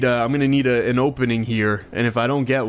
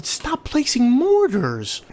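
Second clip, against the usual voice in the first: mock plea; 10 semitones higher, much slower than usual. Pleading at the game.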